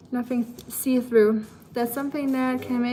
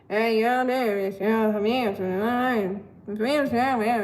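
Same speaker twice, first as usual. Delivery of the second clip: imitating Tennessee locals. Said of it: "They were like".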